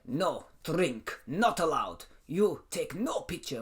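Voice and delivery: blunt, broken-English Russian refusal; imitated big Russian guy; Russian accent. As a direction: lay down the rules as a mock Russian. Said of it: "how do you do a Russian accent".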